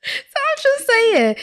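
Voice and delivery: very high and slow, still squealing. Real, not acted. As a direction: stretch it out up high.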